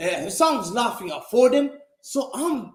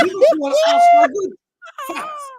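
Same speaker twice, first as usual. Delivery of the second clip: high, whiny mock sobbing; fake-cry it, much higher; mocking boo hoo; 7 dB louder than usual. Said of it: "Oh, boo-hoo".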